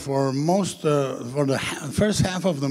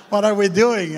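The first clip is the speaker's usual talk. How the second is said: higher, louder retold bewilderment; alarmed protest; 6 semitones higher, 6 dB louder than usual. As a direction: imitate someone exclaiming in comic alarm. Retelling actor's alarmed question to director.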